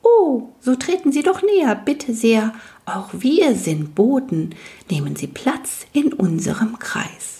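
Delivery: high, twittering polite voice; chirping tiny man. Acted. Tiny man invites the wisp to the fire, high chirping voice. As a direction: chirp a courteous welcome.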